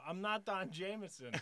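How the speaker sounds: high, loud retold denial; exasperated protest. Mistaken comic to the woman screaming at him, loud exasperated protest. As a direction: raise pitch and volume to protest.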